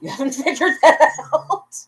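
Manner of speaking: high, loud laughing delivery. Real, not acted. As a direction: burst out laughing through the words. Admits not being sober, laughing.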